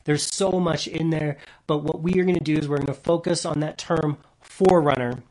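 loud, driving preacher's reading; preaching at full force. Acted. Pastor reading Hebrews to the congregation, preaching loudly. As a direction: proclaim the scripture to the back row.

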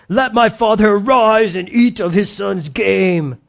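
a hint of a hunter's accent, drawn out; Esau's accent. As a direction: give Esau a rough country accent, slowly. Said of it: "That's his accent".

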